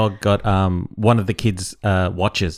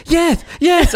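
much higher insistent "yes"; mock frantic yes; 4 dB louder than usual. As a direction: insist like the scared wife. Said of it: "And she goes".

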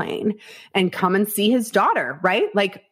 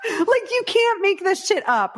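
high laughing disbelief. Real, not acted. Laughing while telling the cohost the story. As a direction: laugh it out, can't believe it. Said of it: "laughing at own story".

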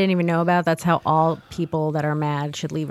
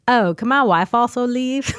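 higher-pitched, badly imitated European accent; half-hearted Scandinavian or Dutch accent; foreign accent attempt; 6 semitones higher, 4 dB louder than usual. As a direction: imitate the foreigner politely asking, accent wobbling. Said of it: "I can't do accents, but he was like".

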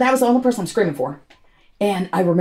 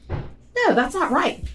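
a high, stunned no; lightly voiced shock, higher; stunned denial; 8 semitones higher, much slower than usual. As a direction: refuse to believe it, voice jumping up. Hearing family are dead.

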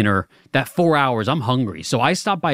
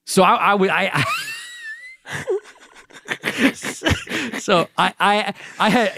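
high-pitched laughter breaking up words; can't finish for laughing; 9 semitones higher than usual. Real, not acted. Cracking up mid story.

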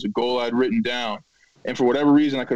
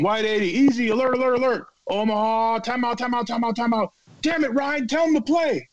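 high, urgent mock shouting of the calls; frantic audible calls; 10 semitones higher than usual. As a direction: rattle off the audibles like a panicked quarterback. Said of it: "he was cussing me out".

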